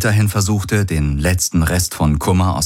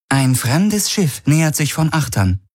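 higher, even computer announcement; ship computer voice; 9 semitones higher than usual. A narrator's reading. The ship's AI warns the crew of an approaching ship, in a higher computer voice. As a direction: report it like the ship's system, higher. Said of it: "hörte er die Vago ... melden".

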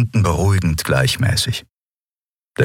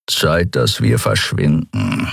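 grumbled; 3 semitones lower, slower than usual. Acted. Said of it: "brummte er".

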